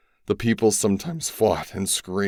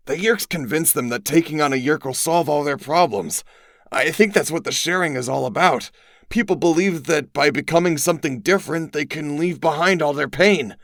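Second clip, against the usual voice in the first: lightly voiced, higher young friend; a hint of a higher boy's voice; Tobias voice; 9 semitones higher, 3 dB louder than usual. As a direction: explain it grimly as Tobias.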